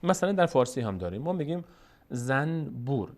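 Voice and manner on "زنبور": "زنبور" slow, split as zan-bur; original spelling form. Shown as a demonstration. Teacher showing the written word, slow zan-bur for "زنبور". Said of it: "اصلش کلمهٔ زنبور هست".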